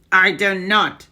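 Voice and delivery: loud barked witch denial; Grand High Witch shouting no. Acted. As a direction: shout it back at her. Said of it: "shouted the Grand High Witch".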